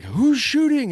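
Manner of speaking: brother-in-law yelling from miles away, high call; distant yell. Retold or imitated. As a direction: call it out high across the woods. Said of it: "he's yelling".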